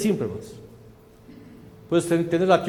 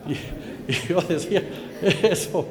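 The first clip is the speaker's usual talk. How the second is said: amused laughing; 3 dB quieter than usual. Real, not acted. Laughing at own mother-in-law joke.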